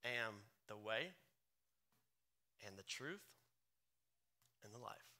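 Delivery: quiet solemn Jesus voice; reverent hush. Acted. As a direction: let each phrase settle gently. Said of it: "Jesus answered".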